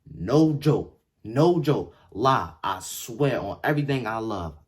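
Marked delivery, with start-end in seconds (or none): none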